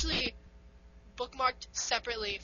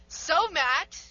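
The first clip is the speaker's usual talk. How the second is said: bright podcast opener, loud and pitched up; happy, upbeat; 6 semitones higher, 11 dB louder than usual. Shown as a demonstration. Co-host opening the show to Matt, happy and upbeat. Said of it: "don't, don't be happy".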